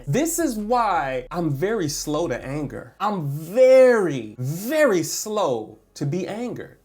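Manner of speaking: normal talking voice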